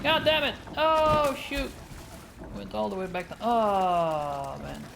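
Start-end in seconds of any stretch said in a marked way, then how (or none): 0.0-1.7 frustrated outburst. Real, not acted. Game setback, frustrated outburst.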